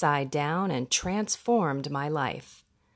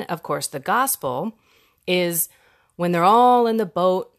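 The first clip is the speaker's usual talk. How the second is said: ordinary delivery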